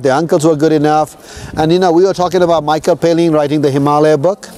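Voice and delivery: ordinary talk, nothing marked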